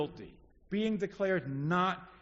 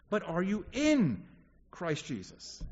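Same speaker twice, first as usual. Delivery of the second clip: "in" leaned on, set against "interested in"; stress on IN. Real, not acted. Preacher challenging the congregation, stress on "in". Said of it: "I'm not asking you, are you interested".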